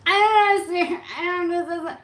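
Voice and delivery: high, loud baffled outburst; comic mock confusion; bewildered. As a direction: throw up your hands in disbelief. Mock bewilderment at rap-loving partner.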